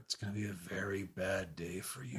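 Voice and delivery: very soft, whispered threat; mumbling to oneself. Acted. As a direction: mumble a menace under your breath, low. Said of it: "you're just mumbling that to yourself".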